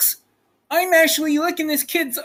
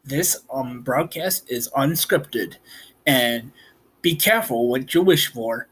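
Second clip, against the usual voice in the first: much lower, quieter movie-trailer announcer; spooky low announcer; deep announcer voice; 13 semitones lower, 4 dB quieter than usual. Pretend. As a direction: warn the audience like a broadcaster. Child does deep announcer voice.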